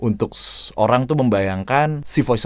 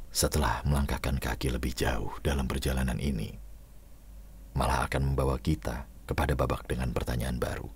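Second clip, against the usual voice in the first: much lower, much softer narrator tone; moody narration; 11 semitones lower, 14 dB quieter than usual. Shown as a demonstration. Coach trying another tone for the trailer narration, low and softer. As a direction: read it hushed and heavy, as a mysterious voice-over. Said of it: "latihan emphasizing, penekanan, dan juga power atau tone suara".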